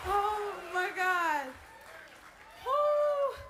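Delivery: stunned, then a high, loud whoop; overjoyed shout. Real, not acted. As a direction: cry out in disbelief at winning. Shocked winner at podium.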